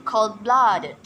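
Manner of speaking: cold-blooded with BLOODED stressed; cold-blooded, weight on the second word. Shown as a demonstration. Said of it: "stress is put on the second word".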